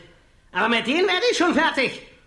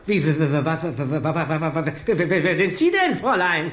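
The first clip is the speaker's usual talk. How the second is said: startled stammer; 9 semitones lower than usual. Acted. Startled old man stammering.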